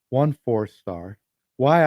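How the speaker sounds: usual speaking voice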